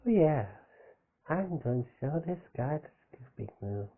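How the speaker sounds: lightly voiced baby talk; a hint of a baby voice. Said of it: "that's my baby voice".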